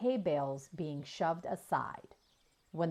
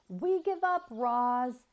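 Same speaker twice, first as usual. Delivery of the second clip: higher, louder call across the yard; defeated shout; 7 semitones higher, 4 dB louder, slower than usual. A narrator's reading. Boy shouting to the hidden robot that they give up. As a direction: call out, giving up. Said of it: "shouted Jad".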